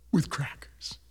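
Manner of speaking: soft whispered punchline. Pretend. Punchline of the stew poem.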